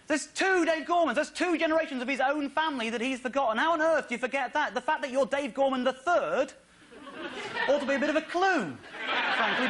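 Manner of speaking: high, loud comic outrage; exasperated rant. Acted. Comic ranting about two forgotten generations of namesakes, exasperated. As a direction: rant in mounting disbelief at the audience.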